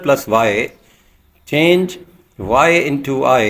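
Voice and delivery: slow, deliberate dictation pace; slow rule reading. Shown as a demonstration. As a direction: spell out the rule slowly for the class. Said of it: "teacher reading rule slowly".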